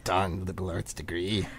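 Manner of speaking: grumbling. Acted. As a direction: mutter in bitter resignation. Grumbles at poor job options.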